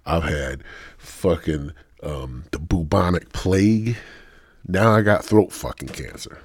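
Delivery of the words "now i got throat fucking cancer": ordinary talk, nothing marked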